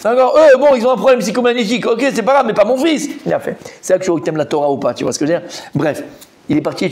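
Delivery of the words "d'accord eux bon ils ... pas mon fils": loud, high indignant protest; indignant father. Retold or imitated. Father protesting that his own son won't go, loudly. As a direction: refuse it outright, offended.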